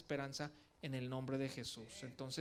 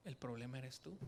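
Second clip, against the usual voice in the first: lowered, soft and loving; tender; 3 semitones lower, 8 dB quieter than usual. Retold or imitated. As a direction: gently tell him the truth. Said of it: "con mucho amor Dios me dijo". (con ternura, en voz más baja y suave)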